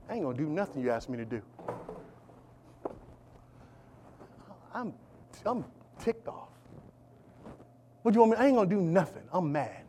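mock a sulking prisoner who won't cooperate; sulky attitude. Preacher imitates Joseph sulking in prison, muttered attitude. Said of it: "he could have had an attitude".

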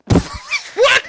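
high, startled what from the friend; shocked what. Retold or imitated. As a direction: jump up in disbelief.

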